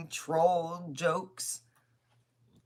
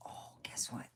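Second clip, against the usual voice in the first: unvoiced whisper, much softer; 12 dB quieter than usual. Real, not acted. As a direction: breathe it as a secret. Confiding aside.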